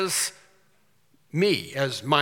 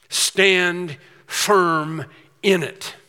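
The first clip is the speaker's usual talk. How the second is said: 아주 느리고 크게 한 단어씩 명령하듯 "Stand firm in it"; 또박또박 힘주어 내리는 명령; imperative command; 2 semitones higher, 4 dB louder, much slower than usual. Shown as a demonstration. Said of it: "it's an imperative".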